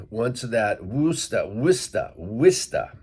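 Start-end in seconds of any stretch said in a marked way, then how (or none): 0.8-1.5 "Worcester" as "WUSS-tuh", vowel of "woo"; R-less local "Woosta" for "Worcester"; local pronunciation. Shown as a demonstration. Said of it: "like that". 1.5-2.9 "Worcester" as "WISS-tuh", repeated; two-syllable local "Wista" for "Worcester"; local pronunciation. Shown as a demonstration. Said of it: "like that".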